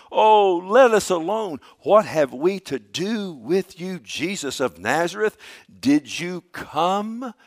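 loud, high, drawn-out wail of words; acted demon's loud plea; demon crying out. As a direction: cry out in protest, stretching the words. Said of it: "he cried out with a loud voice".

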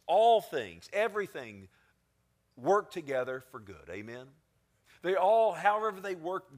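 high, loud exhortation; rally the congregation; fiery preaching. Acted. Pastor rousing the congregation, full-force preaching.

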